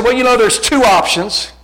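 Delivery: high, forceful explaining; loud retold reply. Preacher retelling his reply to the young man, high and loud. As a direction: lay it out loudly, pitched high.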